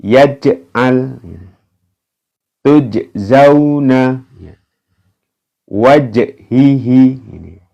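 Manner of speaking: slow recited words with bounced j; Quranic recitation. Shown as a demonstration. Teacher demonstrating qalqalah jim examples, slow. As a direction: recite each word slowly, bounce the j. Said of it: "aj ya".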